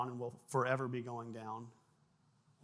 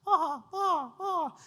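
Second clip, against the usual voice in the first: high loud ah ah cries; imitating band noise, high and loud; drummer's wild cries; 6 dB louder, much slower than usual. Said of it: "start going".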